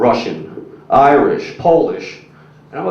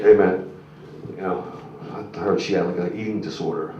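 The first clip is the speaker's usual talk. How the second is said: hushed aside; 6 semitones lower, 8 dB quieter than usual. Retold or imitated. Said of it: "my buddy's like".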